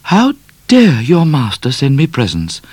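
high, offended lady; high, affronted "How dare". A narrator's reading. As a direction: bristle at the insult. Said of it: "in an offended tone".

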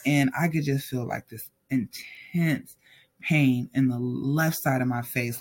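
ordinary delivery

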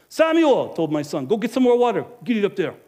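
loud, high call to the son; father yelling an errand to his son. Acted. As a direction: shout across the house to Samuel. Calling son to fetch water.

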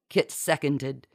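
ordinary delivery, nothing marked

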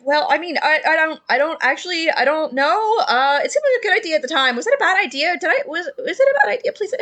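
high, rushed, pleading; sheepish Phineas. Acted. Shirtless drunk explaining himself to Tyrell, high and pleading. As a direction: fumble for an excuse, begging to be told it's fine. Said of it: "He looks at Tyrell and is like".

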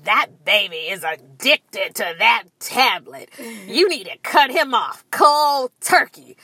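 imitated stern scolding official; judgmental TSA lady. TSA lady criticizing a mother's parenting, judgmental. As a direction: put on a disapproving voice, emphatic. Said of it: "the T S A lady says to me".

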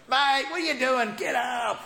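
put-on Australian accent, rousing mates off the ground; Aussie Jesus. Aussie Jesus telling scared disciples in an Australian accent to get up. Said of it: "maybe it was an Aussie Jesus".

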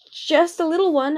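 high, nervous stammer. A narrator's reading. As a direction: stammer out the excuse. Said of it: "Anemone stammered".

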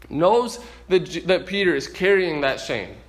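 ordinary delivery

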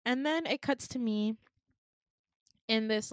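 ordinary talk, nothing marked

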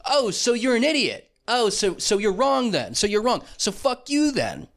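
high-pitched mocking realisation, falling at each end; mock gloating retort. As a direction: rub it in with exaggerated surprise. Gloating reply to the woman.

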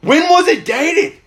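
high, drawn-out panicked dad impression; imitating a rattled, freaked-out father; nervous and scared. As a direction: question it in mock alarm, pitch way up. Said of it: "so fucking nervous and scared".

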